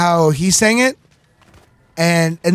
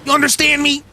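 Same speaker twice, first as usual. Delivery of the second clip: ordinary talk, nothing marked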